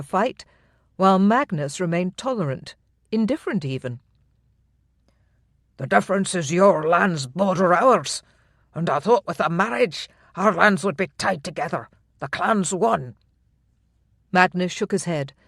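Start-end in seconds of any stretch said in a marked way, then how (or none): 5.8-13.1 lightly voiced Scottish laird, earnest; a hint of a gruff laird pleading his case; Ross laird voice. Laird Ross to Magnus about joined lands, lightly voiced plea.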